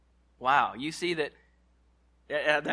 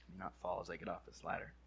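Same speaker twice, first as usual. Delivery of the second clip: muttered aside; 10 semitones lower, 16 dB quieter, faster than usual. Real, not acted. Aside while climbing down.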